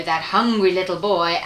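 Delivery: plain reading voice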